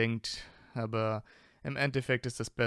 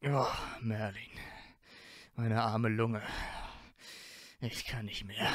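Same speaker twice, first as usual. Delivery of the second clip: leises, gequältes Wimmern, leicht gespielt; wimmernd; 5 dB quieter than usual. Acted. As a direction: wimmere es erschöpft vor dich hin. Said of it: "und wimmerte". (Draco wimmert leise und erschöpft an der Decke)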